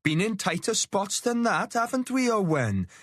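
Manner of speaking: cocky Welsh soldier brushing it off; a hint of Welsh lilt, high and jaunty; Welsh accent. A narrator's reading. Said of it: "in his impossible accent".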